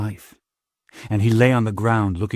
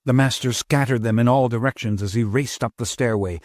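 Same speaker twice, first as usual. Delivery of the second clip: usual speaking voice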